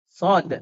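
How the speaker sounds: "sod" long, with a released final d; full letter name. Shown as a demonstration. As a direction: stretch "sod" and close on a bouncing d. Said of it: "nama hurufnya adalah". ("sod" panjang, diakhiri d memantul)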